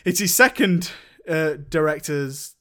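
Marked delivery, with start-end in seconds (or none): none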